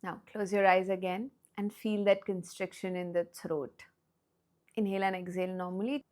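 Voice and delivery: soft whispered instruction; whispered guidance. Acted. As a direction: hush it, eyes closed. Quiet guidance, eyes closed.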